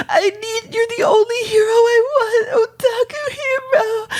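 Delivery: hammed-up high-pitched wailing; mock-sob it in a squeaky, desperate voice; clingy anime girl. Imitating an anime girl grasping at her hero, high mock wailing. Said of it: "this scene of this girl, like".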